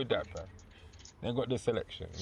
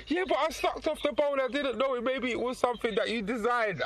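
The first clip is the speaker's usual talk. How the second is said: higher, pleading mock voice of the vegan woman; 7 semitones higher than usual. Retelling a vegan caller's excuse, high mock pleading voice. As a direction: whine it up high like the caught vegan. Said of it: "And then she said".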